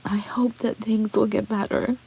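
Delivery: soft, breathy, tearful whisper; hoping through tears, barely voiced. Real, not acted. Vlogger to her viewers, whispering through tears.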